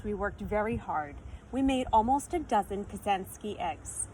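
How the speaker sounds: usual speaking voice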